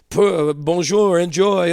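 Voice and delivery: playful fake French accent; mock French waiter. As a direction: toss off a put-on French accent, drawn out. Mock French at fancy restaurant.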